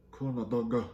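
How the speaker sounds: put-on Korean accent; exaggerated Korean-accented "Corn dog"; mock Korean accent. Shown as a demonstration. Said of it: "you say it with Korean accent".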